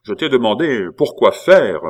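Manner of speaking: loud, insistent repeated question; impatient demand. A narrator's reading. Abbé demands why Pitou came, loudly impatient. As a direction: press him hard for an answer.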